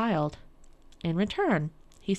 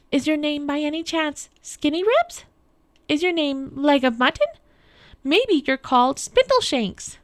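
high, louder questioning queen; queen guessing; 8 semitones higher, 5 dB louder than usual. A narrator's reading. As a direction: try each name in a raised voice. Queen guesses the little man's name.

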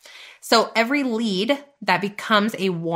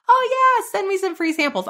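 much higher, sudden bright eager squeal; naive eager newbie; 12 semitones higher, faster than usual. Retold or imitated. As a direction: play the clueless newbie who jumps at freebies. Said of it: "And I was like".

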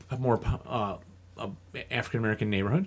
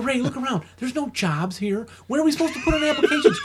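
higher, exasperated, half laughing; 13 semitones higher than usual. Retold or imitated. Arguing with Ray about the jobs, exasperated. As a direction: throw up your hands at it.

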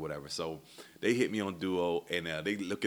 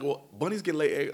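put-on much higher, louder kid voice; daughter's logic; 9 semitones higher, 6 dB louder than usual. Dad voicing daughter defending the Easter Bunny. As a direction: mimic a kid's earnest logic.

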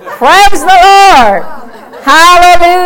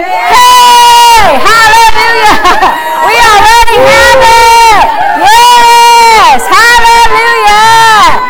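excited shouting praise; 3 semitones higher, slower than usual. Real, not acted. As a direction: whip the crowd into a frenzy. Preacher stirring up the crowd.

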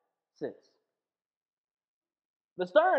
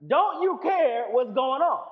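1 urgent plea; 6 semitones higher, 7 dB louder, slower than usual. Retold or imitated. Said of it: "woke him up and said".

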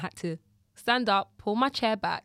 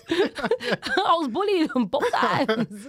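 laughing; 9 semitones higher, 6 dB louder, much slower than usual. Real, not acted. Laughing about being bullied.

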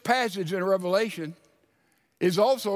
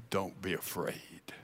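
much softer, lower, gently comforting; soft reassurance; 13 semitones lower, 12 dB quieter than usual. Acted. Jesus gently reassuring the fallen John, softly. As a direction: say it low and soft to calm him.

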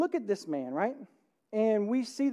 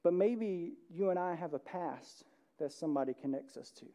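ordinary delivery

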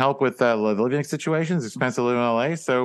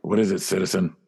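Batman voice; 7 semitones lower than usual. Acted. Batman voice continues.

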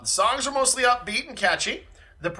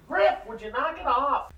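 scolding the cat; 8 semitones higher than usual. Real, not acted. Scolding the cat mid-recording.

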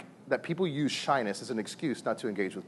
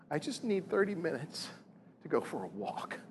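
retold tearful request; 3 semitones higher, 8 dB quieter than usual. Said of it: "In tears".

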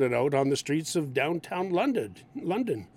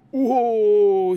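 loud surprised exclamation; impressed whoa; 4 semitones higher, 10 dB louder than usual. Retold or imitated. Skater watches hard fall, loud surprised whoa. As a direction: react to a crash like a skater buddy. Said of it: "somebody might say".

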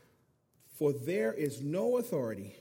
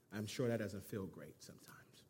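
whispered aside; 9 semitones lower, 13 dB quieter than usual. Real, not acted. Pastor to congregation about a hard command, whispered. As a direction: whisper it, a rueful aside to the room.

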